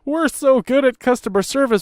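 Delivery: imitating a smug corporate slogan; mock customer-service pitch. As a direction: parody a self-congratulating service line. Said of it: "'cause they're trying to do the".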